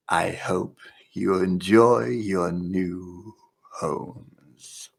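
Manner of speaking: low, very slow sinister farewell; softly drawn-out, ominous devil voice; horned demon owner. Acted. Horned owner to the falling players, low and sinister. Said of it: "the dear owner ... letting two curled horns reveal themselves, saying".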